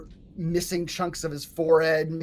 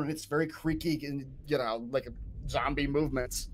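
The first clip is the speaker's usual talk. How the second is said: ordinary talk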